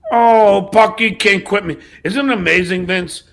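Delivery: ordinary talk, nothing marked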